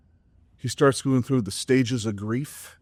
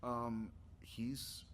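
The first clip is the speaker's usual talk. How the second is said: whispered aside; 20 dB quieter than usual. Retold or imitated.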